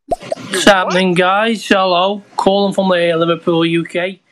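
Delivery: loud phone message; phone recording. Real, not acted. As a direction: shout it down a phone line.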